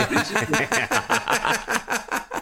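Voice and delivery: words breaking into laughter; a real laugh through the punchline. Real, not acted. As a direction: laugh while getting the joke out. Laughing through own joke.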